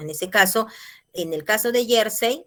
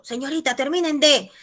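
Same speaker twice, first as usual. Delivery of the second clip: high-pitched pupil's protest; student objecting; 7 semitones higher, 4 dB louder than usual. Acted. Student objects to teacher about record, high-pitched protest. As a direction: pipe up like a pupil correcting the teacher.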